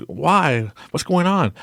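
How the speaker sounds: high pleading whine; hammed-up bewilderment; mock panic. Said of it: "he's freaking out".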